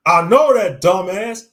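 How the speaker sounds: furious shout. Acted. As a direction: yell it at him. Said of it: "I shouted".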